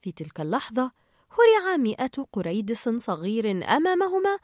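ordinary delivery

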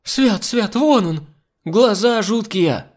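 high, loud terrified wail; wailing in fright. A narrator's reading. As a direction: wail out the alarm. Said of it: "как Нюша завопила". (испуганный высокий вопль)

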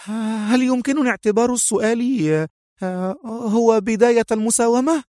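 high woman's voice, tense; high nervous bargaining. Acted. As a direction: probe for a deal, high and edgy. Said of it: "في عصبية".

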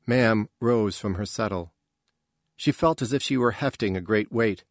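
plain reading voice

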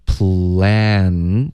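"plan" drawn out slowly onto a clear final n; tongue-tip final n. Shown as a demonstration. Teacher warns learners about the ending, slow "plan" with full final n. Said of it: "一定要顶舌尖".

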